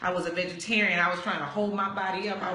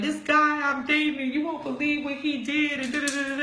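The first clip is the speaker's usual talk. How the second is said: mock wailing, higher and louder; hammed-up sobbing complaint; boo-hoo crying; 7 semitones higher, 4 dB louder than usual. Crying to her friend Daryl about her date, mock wail. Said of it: "boo-hoo crying".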